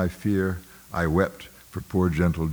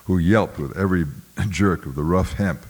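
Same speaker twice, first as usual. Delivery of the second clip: ordinary delivery, nothing marked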